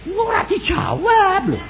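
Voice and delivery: high-pitched, drawn-out mock whine; mock complaint. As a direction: wail it slowly like a petulant complainer. Mimics listener complaining about God.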